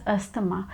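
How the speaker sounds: asthma said as "as-tuh-ma", T sounded; wrong asthma. Shown as a demonstration. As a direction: mispronounce asthma in three syllables. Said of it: "We don't pronounce it as".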